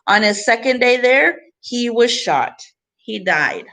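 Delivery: expressive picture book reading; reading to children. Acted. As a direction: read the book to the class with gentle emphasis. Teacher reads picture book aloud.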